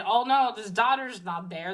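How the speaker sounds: normal talking voice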